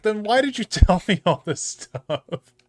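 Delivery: high, loud, laughing exasperation; exasperated. Retold or imitated. To coworker whose heart was already fixed, exasperated. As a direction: protest in mock outrage, laugh breaking in. Exasperated reply after anticlimax.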